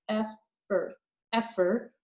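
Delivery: effer repeated as two joined chunks; blending chunks. Shown as a demonstration. Teacher blending a long word piece by piece. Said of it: "I go like this".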